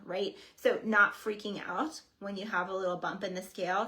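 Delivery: usual speaking voice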